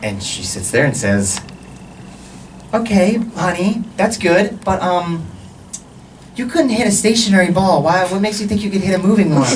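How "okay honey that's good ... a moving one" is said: higher, gently doubting mom voice; nice mother. Acted. Nice mother doubting her son's baseball plan, sweetly patronizing. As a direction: let him down sweetly, pitched up. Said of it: "my nice mother".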